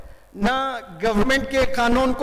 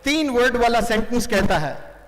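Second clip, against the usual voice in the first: ordinary talk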